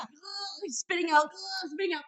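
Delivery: high, retold spitting disgust; frantic. Wife retells husband rinsing his mouth, high and frantic. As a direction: act out a frantic mouth rinse. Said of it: "like, frantic".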